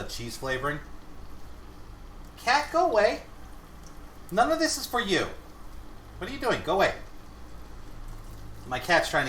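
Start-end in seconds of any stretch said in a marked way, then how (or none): 2.4-6.9 high-pitched shooing of a pet; scolding the cat. Real, not acted. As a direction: shoo the cat away, high.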